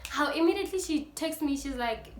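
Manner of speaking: ordinary talk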